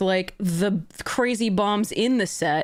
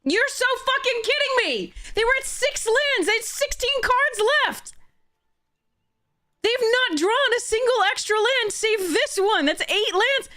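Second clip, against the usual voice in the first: much higher, frantic indignant outburst; exasperated rant; 12 semitones higher than usual. Real, not acted. Player ranting at opponent's perfect land draws, high-pitched outrage. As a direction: shriek it in disbelief at the bad luck.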